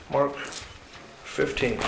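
"Mark fifteen" drawn out very slowly and softly, to himself; slow muttered reference. Shown as a demonstration. Preacher looks up the verse, slow and soft. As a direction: murmur "Mark fifteen" slowly while finding the page. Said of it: "What did I say?".

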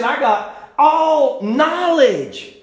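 slow, high, drawn-out boast; smug know-it-all drawl; boastful Paul. Acted. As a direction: puff up and savor every word, pleased with yourself. Paul boasting, acted quote.

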